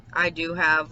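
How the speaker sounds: normal talking voice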